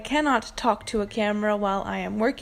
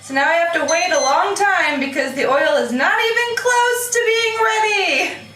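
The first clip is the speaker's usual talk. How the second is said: high whine climbing higher and stretching out; exasperated complaint; 7 semitones higher, 4 dB louder than usual. Real, not acted. Waiting on oil that isn't ready, climbing exasperated whine. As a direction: whine in frustration, dragging out the ending.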